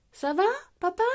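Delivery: high, mildly worried question. A narrator's reading. Peppa to fallen father, worried. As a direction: ask with a touch of alarm. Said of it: "s'inquiète Peppa".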